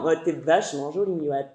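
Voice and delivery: a man's sleazy compliment from his car; low leering man. Acted. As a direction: purr it like a creep leaning out of a car. Man in car harassing cyclist.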